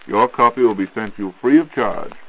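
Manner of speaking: normal talking voice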